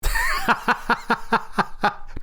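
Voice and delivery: bursting out laughing; a genuine high laugh at the joke; real laugh. Real, not acted. Host laughs at co-host's jab, real laugh.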